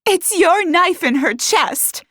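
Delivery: high, loud, slow accusation; accusing. A narrator's reading. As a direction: hurl the blame, hitting "your". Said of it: "Harper accused".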